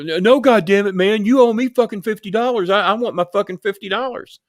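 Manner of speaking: hammed-up furious caller wanting his cash; mock angry rant. Retold caller insisting on money.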